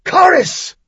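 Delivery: a warrior's full-throated war cry; booming battle cry. A narrator's reading. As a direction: roar the battle cry, high and loud. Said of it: "Merlin's deep voice boomed the battle cry".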